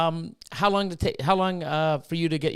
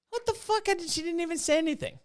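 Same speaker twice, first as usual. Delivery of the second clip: high, thin, quiet squeal of disbelief; mock disbelief; 12 semitones higher, 5 dB quieter than usual. Speaker retells seeing the birthday post, in high quiet disbelief. Retelling his shocked reaction online.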